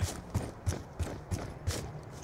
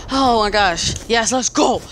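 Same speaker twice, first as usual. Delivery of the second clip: ordinary delivery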